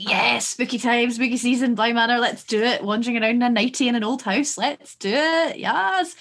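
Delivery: hyped-up retelling of fan excitement; excited anticipation. Retold or imitated. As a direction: build to a high, gleeful yes. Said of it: "then I was like".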